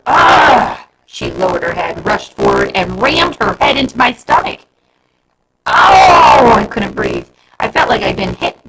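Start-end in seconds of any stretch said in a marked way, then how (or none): 0.1-0.9 loud roar as she charges. A narrator's reading. Girl roaring at Bernie as she rams him, loud roar. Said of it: "Jennifer let out a roar". 5.6-6.5 loud pained oof; knocked breathless; winded grunt. A narrator's reading. Bernie hit in the stomach by Jennifer, loud winded oof.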